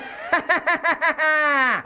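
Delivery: high breathy cackle after the voice; giggling fit; high giggle. Real, not acted. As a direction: laugh it off high. Laugh after Cookie Monster bit.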